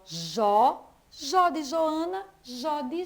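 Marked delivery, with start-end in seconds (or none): 0.0-0.9 "jó." said alone and louder, one open syllable; teacher showing the first sound "jó."; isolated syllable. Shown as a demonstration. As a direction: hold up "jó." on its own. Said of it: "O som inicial".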